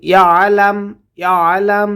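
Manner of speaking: ইয়ালাম with the মা held one beat; one-alif madd. Shown as a demonstration. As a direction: stretch the a of মা for one count. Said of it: "মা একাল টান হবে". (ইয়ালাম শব্দে মা এক আলিফ টেনে)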